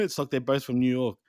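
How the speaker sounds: ordinary delivery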